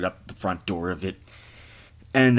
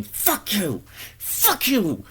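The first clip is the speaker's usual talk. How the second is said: much higher mock screaming fit; 12 semitones higher, much slower than usual. Cashier screaming at the man who vomited, mock shriek. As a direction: shriek it at the customer, hammed-up. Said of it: "the cashier's just screaming".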